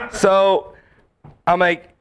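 ordinary delivery

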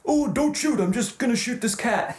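high pleading puppet voice; Alf voice. Retold or imitated. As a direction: beg like a cartoon alien puppet. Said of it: "And Alf's like".

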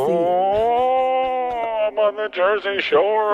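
very high, whiny dying ghost. Pretend. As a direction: squeal it like a panicked spirit.

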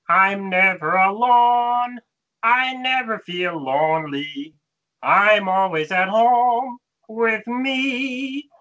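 slow, drawn-out puppet voice, lifted in pitch; sustained, slow character voice; Boober Fraggle impression. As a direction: stretch every word out long and slow. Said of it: "that was Booba Fraggle".

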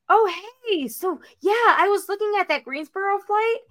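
high-pitched, put-on apologetic voice; awkward letdown. [name] lets traveler down about the flight, awkward.

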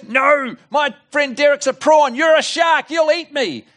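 scared prawn refusing to open the door; scared refusal. Acted. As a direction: refuse in fear.

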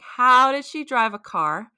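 ordinary delivery

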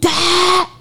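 explosive yell. Retold or imitated. Gun fires, retold start burst.

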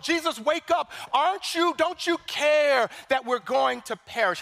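high, pleading cry to wake him; panicked plea. Acted. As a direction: beg a sleeping Jesus for help in terror. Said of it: "the disciples are afraid".

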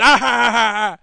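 loud short villain cackle; a quick booming cartoon cackle; cartoon villain laugh. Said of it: "laughing about nothing".

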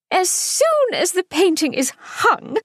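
high, bird-like chirping society matron; chirpy hostess. A narrator's reading. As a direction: chirp it brightly, a fussy hostess. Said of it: "Missus York chirped".

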